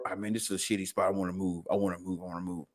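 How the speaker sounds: low, soft, sulky mutter, imitated; sulky brother. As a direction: mumble it like a fed-up little brother. Brother sulking about a bad spot.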